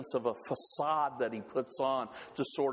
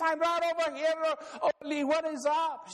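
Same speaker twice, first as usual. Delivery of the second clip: Lena's voice; 12 semitones higher than usual. Acted. Lena answering Ole's shouting, high put-on voice. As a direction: call back to Ole, pitched way up. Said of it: "And she says".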